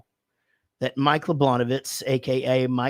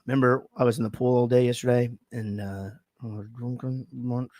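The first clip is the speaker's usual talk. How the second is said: ordinary talk, nothing marked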